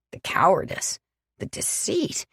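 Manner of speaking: whispered contempt; disgusted hiss. Acted. The professor condemning Alex to Sam, hissed. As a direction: spit the words out under your breath.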